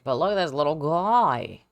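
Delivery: loud, proud cooing over the model; excited gushing. Real, not acted. Admiring her sculpt, loudly gushing over it. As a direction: gush over the little creature.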